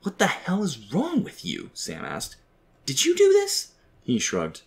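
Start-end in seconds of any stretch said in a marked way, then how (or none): 0.0-1.7 high-pitched, indignant demand; incredulous, exasperated questioning; exasperated outburst. A narrator's reading. Sam angrily confronts his father. 2.8-3.7 very high, loud accusation; incredulous, high-pitched accusing cry; accusing outburst. A narrator's reading. Sam accuses his father.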